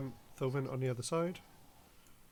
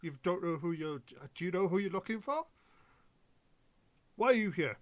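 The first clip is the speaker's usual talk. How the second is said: impatient guard; 4 semitones higher, 4 dB louder, faster than usual. Acted. GM voices impatient dwarf guard.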